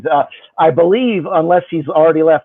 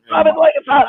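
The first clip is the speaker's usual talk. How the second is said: high, bright excited repeat; excited echo; 9 semitones higher than usual. Acted. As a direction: throw "[name]" up high with delight. Echoing the catchphrase with a big jump.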